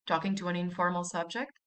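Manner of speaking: usual speaking voice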